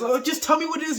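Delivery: put-on high, pleading girl voice; mock a curious girl begging; girl asking. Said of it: "And then she says".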